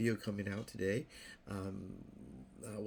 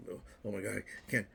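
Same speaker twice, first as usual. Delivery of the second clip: usual speaking voice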